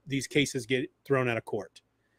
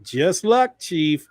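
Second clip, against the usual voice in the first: put-on old radio-show voice. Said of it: "that would have been the voice on the radio show".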